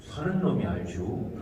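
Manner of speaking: slow, unbothered Chungcheong reply; laid-back Chungcheong seller. Retold or imitated. Chungcheong seller answering a customer's price question, slow and unbothered. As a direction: let it drift out slowly in Chungcheong style, no rush to sell. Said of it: "이렇게 얘기해요".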